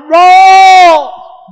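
loud, high, drawn-out holler; hollered name. Retold or imitated. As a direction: holler for your brother to save you. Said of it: "I hollered".

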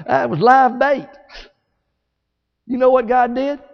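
normal talking voice

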